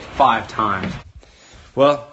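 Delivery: normal talking voice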